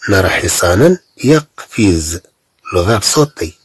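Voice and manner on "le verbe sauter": normal talking voice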